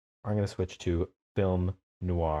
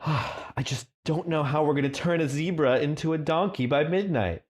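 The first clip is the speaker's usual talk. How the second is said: exasperated fantasy character; 7 semitones higher than usual. Acted. Dramatic dialogue line, sighing.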